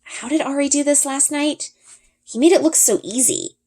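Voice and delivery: ordinary delivery, nothing marked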